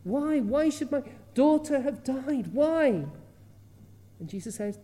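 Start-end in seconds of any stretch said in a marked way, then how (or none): 0.0-3.1 high, pleading cry of why; a grieving father crying out to heaven; rising, louder grief-stricken questioning; anguished father. Retold or imitated. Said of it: "The man must have been saying".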